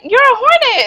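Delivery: acting out giddy excitement; so excited. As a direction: squeal it with hyped school pride. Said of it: "I was so excited".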